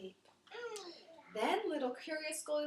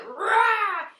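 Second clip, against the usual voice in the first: loud high mock roar; loud playful bear growl; 9 semitones higher, 16 dB louder than usual. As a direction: roar to scare her. Three bears roar.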